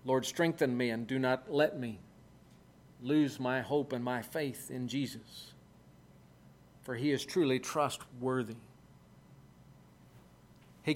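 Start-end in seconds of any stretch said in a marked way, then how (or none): 0.0-8.6 low, soft model prayer; humble prayer. Shown as a demonstration. Preacher modelling a prayer for strength, low and soft. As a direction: pray it quietly, pitched well down. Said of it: "our prayers need to be along the lines of".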